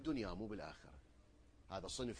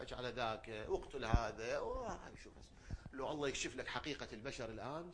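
normal talking voice

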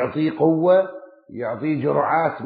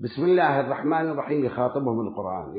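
ordinary talk, nothing marked

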